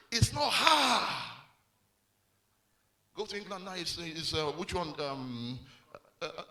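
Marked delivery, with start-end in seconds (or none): 0.1-1.1 louder, punched repeat of the line; preacher's emphatic shout. Real, not acted. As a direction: hammer it home to the crowd.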